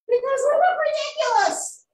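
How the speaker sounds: high, loud indignant chorus; all protest at once; group outcry. A narrator's reading. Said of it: "says everyone together".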